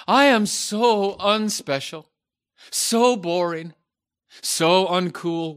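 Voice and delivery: loud, whiny woe-is-me lament; hammed-up self-pity, wailing; dramatic sigh. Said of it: "the reverse".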